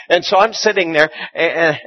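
usual speaking voice

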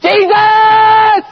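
long, high holler; yelling out. Acted. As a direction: scream the name, stretched out. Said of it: "this guy's yelling out".

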